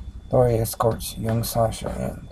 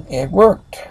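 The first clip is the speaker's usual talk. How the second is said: high, loud triumphant cry; thrilled, raised voice; amazed exclamation; 7 semitones higher, 10 dB louder than usual. Acted. Young again, retakes line.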